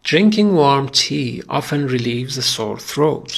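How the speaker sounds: very slow, each word laid out for repeating; slow read-along. Shown as a demonstration. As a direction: read it slowly so they can follow. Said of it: "با من بخون".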